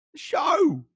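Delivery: squeaky high call of the show. Acted. Calling out to start the show.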